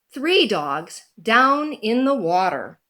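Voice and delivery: plain reading voice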